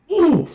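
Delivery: very high, loud excited whoop; excited "ooh". Real, not acted. Watcher reacting to a shot on goal, excited high whoop. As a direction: let out a sudden high "ooh!".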